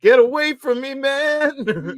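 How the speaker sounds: high, loud mock-horrified cry; panicked RoboCop victim. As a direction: wail it in exaggerated panic. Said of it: "where he's, like, covered in acid".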